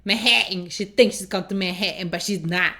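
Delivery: Manhattan with the middle t's swallowed; mimicked Manhattan pronunciation. Viewer repeating her favorite line, Manhattan with t's dropped. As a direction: imitate Manhattan as Man-ha'-an. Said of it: "her saying Manhattan".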